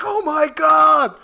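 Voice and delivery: loud, slow, high Oh my God; a cinema crowd gasping at the reveal; stunned crowd. Retold or imitated. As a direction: yell in total disbelief. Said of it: "people were like".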